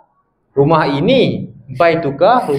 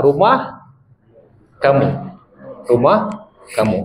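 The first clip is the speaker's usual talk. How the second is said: ordinary delivery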